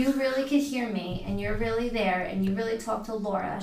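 normal talking voice

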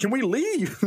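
a worn-out suspect finally caving and begging; cracking suspect. Acted. Suspect begs to leave.

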